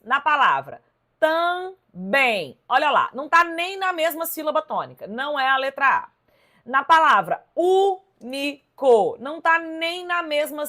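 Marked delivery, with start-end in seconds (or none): 1.2-2.6 "também" devagar, dividido em tam-bém; separando em sílabas. Shown as a demonstration. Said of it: "a gente separa assim". 7.5-9.2 "único" bem devagar, em ú-ni-co; separando em sílabas. Shown as a demonstration. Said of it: "a gente separa assim".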